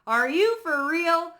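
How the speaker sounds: high, loud, slow are you for real; disbelief. Retold or imitated. Waking from the dream, loud slow disbelief. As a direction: drag it out in disbelief. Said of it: "I woke up and I'm like".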